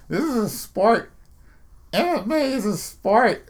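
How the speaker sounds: high, drawn-out whine on 'sport'; mock the losers in a high, stretched voice; mocking complainers. Host mimicking losers who insist MMA is sport, mocking. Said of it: "all you fucking losers that are out there talking about".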